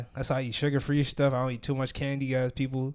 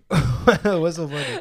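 loud, slow question through laughter; helpless laughter breaking up the words; laughing; 3 semitones higher, 7 dB louder, much slower than usual. Real, not acted. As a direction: crack up while asking. Laughing while asking why they laugh.

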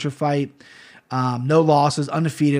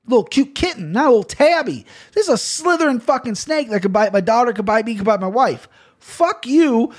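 higher, heated and indignant; angry rant; 8 semitones higher than usual. Real, not acted. Answering viewers who scolded him for killing the snake, heated and high. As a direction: tell the critics off, fired up. Ranting at people who criticized.

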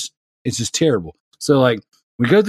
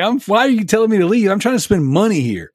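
retold indignation; 8 semitones higher than usual. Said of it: "I was like".

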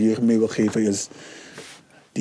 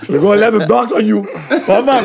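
mock threat; 9 semitones higher, 6 dB louder than usual. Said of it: "dat was een grapje".

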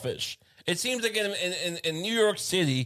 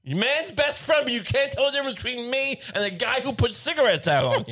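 high, hammed-up pleading rant; mock exasperation; 7 semitones higher than usual. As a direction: argue with the dog as the wronged owner. Acted owner berating the dog.

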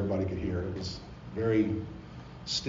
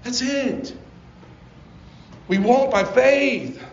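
higher, louder, slow and emphatic; full-force preaching; 14 semitones higher, 9 dB louder, much slower than usual. Acted. Pastor preaching to the congregation about God's love, impassioned.

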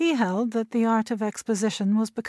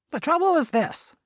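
sharp and much higher, snapping the words; sharply raised old man's voice; 8 semitones higher, 3 dB louder than usual. Acted. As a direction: cut in sharply to get attention. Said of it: "went on sharply".

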